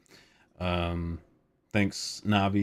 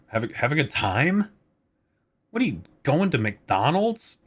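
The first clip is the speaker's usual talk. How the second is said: mocking himself; 7 semitones higher than usual. Real, not acted. Mocks own sign-off.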